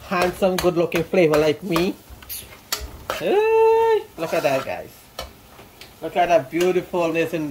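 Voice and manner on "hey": sudden high excited squeal of "Hey"; high-pitched voice. Real, not acted. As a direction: jump way up high on the greeting. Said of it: "excuse me my high-pitched voice".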